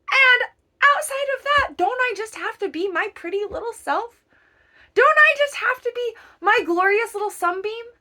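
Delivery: high, loud, sing-song little sunbeam voice; playful self-praise. Pretend. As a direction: preen like a proud little sunbeam.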